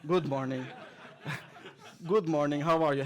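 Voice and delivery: ordinary talk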